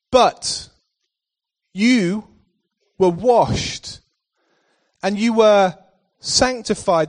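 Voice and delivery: slow solemn proclamation; proclaimed slowly. Acted. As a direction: let every word land, pausing.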